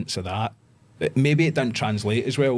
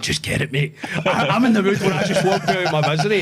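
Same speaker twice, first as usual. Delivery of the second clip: laughing taunt; 11 semitones higher, 6 dB louder than usual. Retold or imitated. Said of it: "I went".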